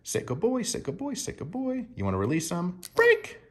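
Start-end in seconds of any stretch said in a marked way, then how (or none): 0.0-1.9 much higher, softer praise; high soft dog praise. Shown as a demonstration. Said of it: "we even praise in a certain way". 2.9-3.4 free the dog with a joyful call; high-pitched happy release cue. Shown as a demonstration. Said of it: "very happy manner, a high-pitched voice".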